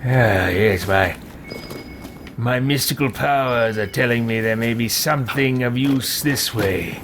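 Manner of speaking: low, grand mystic voice; theatrical sorcerer sensing secrets; hammy mystical mage. Pretend. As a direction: muse portentously as an evil wizard. Mystic character voice while exploring.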